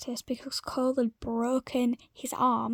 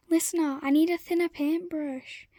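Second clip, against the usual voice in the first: raised voice calling up from a ladder, higher; shout; 4 semitones higher, 6 dB louder than usual. Acted. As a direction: call down loudly for a brush. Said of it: "Cole shouted".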